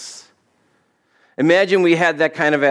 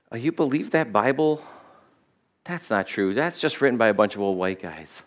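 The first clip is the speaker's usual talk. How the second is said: low, soft skeptical dismissal; imitated scoffer; 3 semitones lower, 6 dB quieter than usual. Preacher voices someone doubting the Bible, understated scoffing. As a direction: play a mildly scornful doubter, quietly. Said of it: "they say something like".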